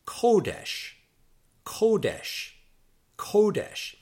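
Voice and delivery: "kodesh" as KOH-desh, high on KOH, then falling; stress on first syllable. Shown as a demonstration. Teacher models the word for holiness, "kodesh" stressed high on the first syllable. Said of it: "the accent there is on the first syllable".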